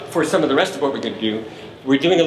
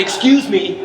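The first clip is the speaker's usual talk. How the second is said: "excuse me" pushed out louder like get out of my way; insincere excuse me; 5 semitones higher, 6 dB louder, slower than usual. Shown as a demonstration. Composer demonstrating the New York "excuse me", pushy and louder. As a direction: say "excuse me" as if you mean move. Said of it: "like that, okay?".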